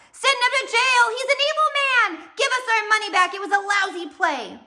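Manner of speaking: very high, loud jeering yells; crowd heckling. Acted. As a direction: shout him down like an angry theatre crowd. Angry audience shouting at villain.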